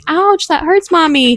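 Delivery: mock gentle mother saying ouch; mom's gentle voice. Retelling her mom scolding a biting child, mock gentle. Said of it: "she's like".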